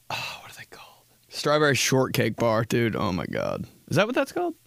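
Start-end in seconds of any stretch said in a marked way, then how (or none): 0.1-1.0 soft voiceless aside; hushed wondering whisper. Real, not acted. Whispered to himself. 2.9-4.5 surprised. Real, not acted. Surprised realization.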